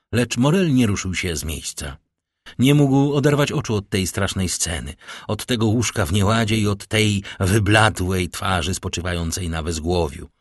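ordinary delivery

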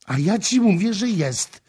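high, loud insistence; loud, high-pitched defiance; furious shout. A narrator's reading. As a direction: shout it back, pounding the point home. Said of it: "krzyczała, bijąc pięścią w stolik".

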